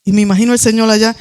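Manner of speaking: usual speaking voice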